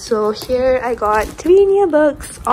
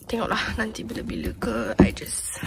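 whisper; 7 dB quieter than usual. Real, not acted. Whispered aside in Malay.

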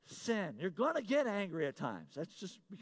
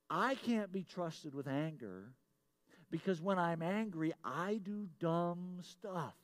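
slow, drawn-out admission at usual volume; measured confession; 3 semitones lower, slower than usual. Real, not acted. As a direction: confess it slowly, stretching the last words. Confesses, waits for congregation to respond.

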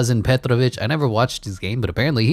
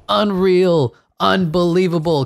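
higher and louder, stunned disbelief; excited; 8 semitones higher, 5 dB louder, slower than usual. Real, not acted. Announcer reacting to Rockets upsetting the Lakers, excited disbelief.

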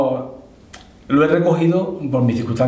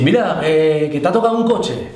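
imitating the priest; 5 semitones higher than usual. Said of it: "Y dice".

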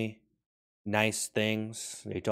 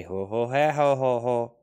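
dolphin chant reply; 5 dB louder, slower than usual. Acted. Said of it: "And they say".

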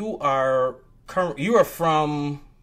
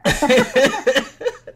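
laughing; 14 semitones higher, 7 dB louder than usual. Real, not acted. Host laughs at accent remark.

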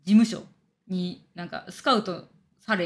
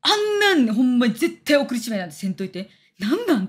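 louder, emphatic telling-off; retold angry scolding; 2 semitones higher, 5 dB louder than usual. Friend scolding her over choosing that host, angrily. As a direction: imitate a friend forcefully scolding you. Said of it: "のんちゃんにマジで怒られた".

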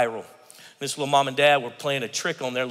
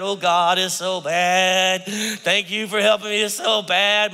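whiny lament; 3 semitones higher, 3 dB louder, slower than usual. Pretend. Mocking gloomy complainer.

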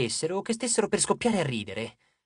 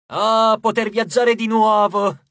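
louder, slow, higher cry of delight; joyful cry; 4 semitones higher, 8 dB louder, slower than usual. A narrator's reading. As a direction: cry out, thrilled to travel again. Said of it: "gridò Trip". (grido felice, più alto e forte)

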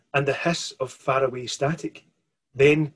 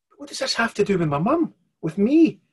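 put-on young girl's voice, higher and stammering; a scared child demanding answers; frightened girl; 8 semitones higher, 3 dB louder than usual. Mirren asking the stranger about her mum, frightened. Girl character alarmed, pressing.

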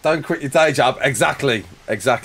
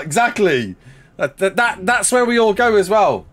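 ordinary talk

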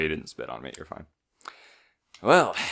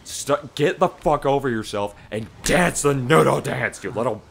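higher, louder yelling at a cartoon character, laughing partway; mock furious yell; 6 semitones higher, 7 dB louder than usual. Yelling at the cartoon character who refuses to dance, mock furious. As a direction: rant at him like he's ruined the dance again. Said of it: "I'd be like".

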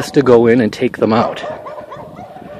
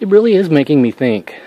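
normal talking voice

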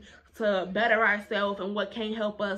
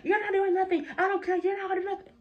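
high-pitched mimicking taunt; mock defiant teacher; 9 semitones higher than usual. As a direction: mimic him squaring up, pitched way up. Mimics teacher squaring up to her.

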